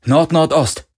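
high, loud one-word order; sharp command. A narrator's reading. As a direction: call it out to the helm. Pilot calls out the course. (lautes, hohes Kommando)

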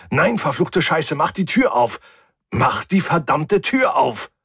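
high, frantic cursing shout. A narrator's reading. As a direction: yell desperately at a closing door. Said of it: "schrie ich". (panisch fluchend geschrien)

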